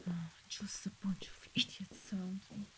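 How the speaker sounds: exasperated whisper to oneself; hushed, private complaint; whispered grumble. Acted. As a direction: grumble under your breath, all whisper. Boss grumbles to himself alone.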